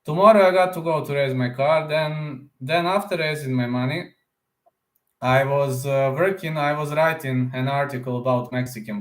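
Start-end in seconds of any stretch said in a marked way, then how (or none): none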